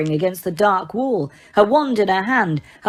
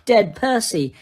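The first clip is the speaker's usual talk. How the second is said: ordinary delivery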